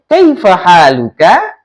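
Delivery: slow "Kaifa haluka" climbing at the end; questioning rise. Shown as a demonstration. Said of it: "Nada naiknya". (nada naik di ujung "Kaifa haluka", pelan)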